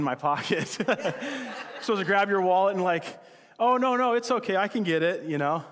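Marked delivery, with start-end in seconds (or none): 3.6-5.2 high-pitched mock protest over the bill; polite protest. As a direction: make a show of insisting on paying. Acts out offering to pay.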